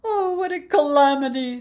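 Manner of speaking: high, loud dismayed exclamation; a loud, high cry of dismay; exclaimed. Acted. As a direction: wail it while wringing your hands. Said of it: "the innkeeper exclaimed and wrung his hands".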